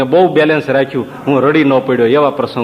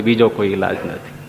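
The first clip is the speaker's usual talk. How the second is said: બીજો કોઈ ઈલાજ નથી much lower and softer, trailing off; soft echo; 7 semitones lower, 5 dB quieter than usual. Real, not acted. Preacher repeats to the assembly as a low, soft echo.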